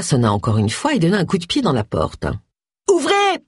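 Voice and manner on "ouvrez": very high-pitched demanding yell. A narrator's reading. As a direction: yell it high through a closed door. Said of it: "hurla-t-elle". (hurlement aigu et impérieux)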